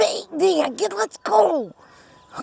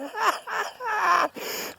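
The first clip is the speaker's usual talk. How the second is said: high, breathless nervous laugh; a terrified giggle; panicked laugh; 5 semitones higher, 10 dB quieter than usual. Real, not acted. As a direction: laugh from fright.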